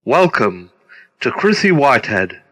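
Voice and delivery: usual speaking voice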